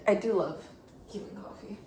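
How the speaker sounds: usual speaking voice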